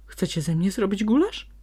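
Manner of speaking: loud, high frightened yell; fox yelling in fright. A narrator's reading. Fox terrified of becoming stew yells at captors. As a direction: yell it, teeth chattering. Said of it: "wrzasnął Ziółko".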